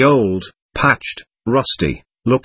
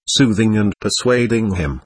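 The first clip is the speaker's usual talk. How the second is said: ordinary delivery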